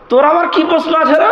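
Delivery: put-on high, teasing put-down of a cheeky boy; mocking preacher to a child; mocking high taunt. Repeats the taunt.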